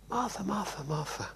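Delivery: high tender call fading to a soft hush; gentle calling. Acted. Jesus gently calling flustered Martha from the lounge, tender and fading. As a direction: call her name gently, letting the last fall almost to a whisper.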